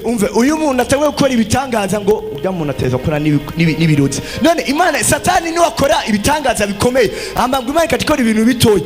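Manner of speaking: normal talking voice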